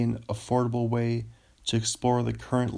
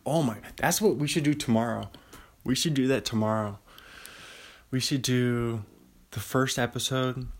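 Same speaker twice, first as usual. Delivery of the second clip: ordinary delivery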